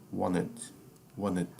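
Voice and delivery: "wanted" said wo-ne, with no T at all; silent T. Shown as a demonstration. Teacher modelling "wanted" without its T for the class. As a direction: swallow the T in "wanted" completely. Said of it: "você não vai pronunciar o fonema da letra T".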